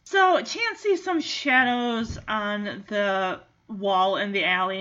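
usual speaking voice

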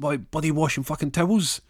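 heated, indignant question; acted anger. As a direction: snap at him in exasperation. Wife swearing in acted quarrel.

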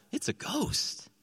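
a breathless, high-pitched gasp of fear; slow, whispered fright like a terrified sailor; frightened cry. A narrator's reading. Disciples in the boat see a figure on the water, terrified gasp. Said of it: "they cried out".

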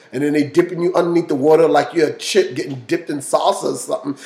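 ordinary delivery

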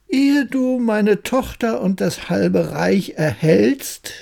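slow, deliberate king setting a condition; scheming king. Acted. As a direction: lay down the terms slowly as a grudging king. King sets a new task.

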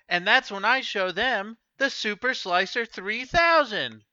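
upbeat salesman ad read; infomercial pitch. Acted. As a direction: sell the knife like a TV ad.

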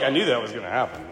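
low, quick, muttered grumble; resigned Moses. Retold or imitated. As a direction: mutter it under your breath, fed up. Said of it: "you gotta think Moses is like".

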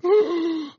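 sharp loud high gasp. Retold or imitated. Healed woman gasping as she moves her leg, loud gasp. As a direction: suck in air in sudden shock.

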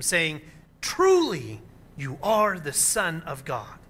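measured, solemn reading of the Gospel; scripture reading. Acted. Pastor reads the Gospel to the congregation, solemn and measured. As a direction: read the passage aloud to the congregation.

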